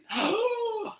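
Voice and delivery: high, drawn-in gasp; delighted gasp. Real, not acted. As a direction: gasp in admiration. Admiring shiny boot.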